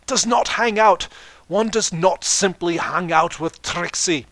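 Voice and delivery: put-on Trixie in a broad Scottish accent, slow and loud. Reader gives Trixie a Scottish accent, loud and proud. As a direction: roll it out grandly in Scottish brogue. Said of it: "giving her a Scottish accent now".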